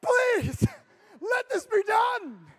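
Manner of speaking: high, loud, slow pleading cry; desperate plea. Retold or imitated. Exhausted leader begging God at the hotel desk, loud desperate plea. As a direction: beg heaven to end the night. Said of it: "and I'm like".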